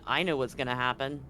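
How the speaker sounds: ordinary talk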